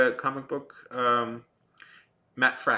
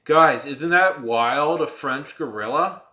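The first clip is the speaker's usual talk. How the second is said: louder, slow, ironic drawn-out question; mock-sarcastic hype; 4 semitones higher, 9 dB louder, much slower than usual. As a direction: sell a dud punchline, tongue in cheek. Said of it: "I'm pausing so I can let you laugh".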